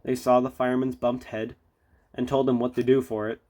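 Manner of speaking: ordinary delivery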